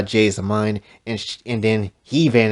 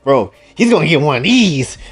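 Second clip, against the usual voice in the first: fired-up threat; 4 semitones higher, 6 dB louder than usual. Real, not acted.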